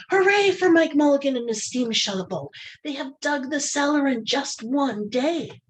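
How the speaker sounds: high excited cheer; cheering crowd. Acted. People cheering the finished cellar, high and excited. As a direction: celebrate the dig. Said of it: "shouted the people".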